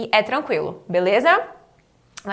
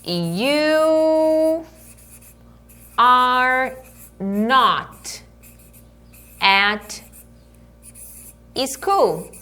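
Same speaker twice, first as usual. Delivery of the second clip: long pauses between stretched words; very slow teacher demonstration; word-by-word model; much slower than usual. Shown as a demonstration. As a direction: dictate it one word at a time. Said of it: "Eu falo".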